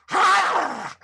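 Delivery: high, loud growling huff; bear growl. A narrator's reading. Bear growling after losing at dreidel, grumpy. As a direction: growl in frustration. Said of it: "growled Old Bear".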